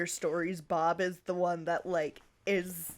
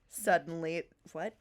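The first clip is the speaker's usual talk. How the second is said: plain reading voice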